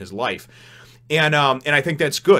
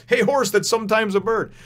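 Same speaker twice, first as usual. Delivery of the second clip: goofy Luffy calling out; 11 semitones higher, 5 dB louder than usual. Retold or imitated. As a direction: sing out each silly nickname like carefree Luffy. Another Luffy greeting.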